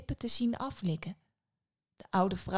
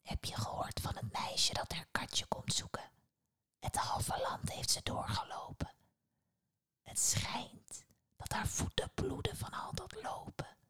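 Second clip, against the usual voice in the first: hushed, voiceless gossiping whisper; soft conspiratorial whisper; 5 semitones higher, 9 dB quieter than usual. A narrator's reading. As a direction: whisper it secretly to a companion. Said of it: "De ander fluisterde".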